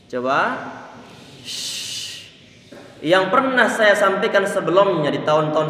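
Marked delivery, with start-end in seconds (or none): 1.4-2.3 Shh hissed softly with air spread across the mouth; shin hiss. Shown as a demonstration. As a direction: blow a soft spreading Shh. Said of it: "Angin perlu untuk dibuat nyebar".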